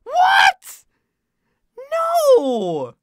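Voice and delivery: very high, loud drawn-out shriek; real gamer's howl of disbelief; anguished scream. Real, not acted. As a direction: scream in horror at the loss. Dies suddenly in game.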